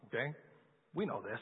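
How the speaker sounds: ordinary talk, nothing marked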